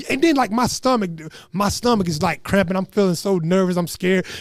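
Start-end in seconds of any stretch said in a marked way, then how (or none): none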